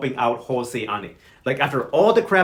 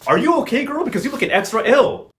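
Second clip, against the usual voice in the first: high, louder mock concern; concerned questioning; 7 semitones higher, 4 dB louder than usual. [name] asks if she's okay, high-pitched concern. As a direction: imitate someone checking on a sick friend, pitched up.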